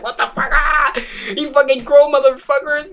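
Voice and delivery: high-pitched mock yelling of a horrified man; outraged shriek. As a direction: imitate someone screaming in disgust. Acts out horrified man yelling at kids.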